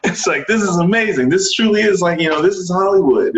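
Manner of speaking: very high, breathless wonder; starstruck excitement. Retold or imitated. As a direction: gush like a sheltered kid seeing Hollywood. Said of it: "I truly geek out".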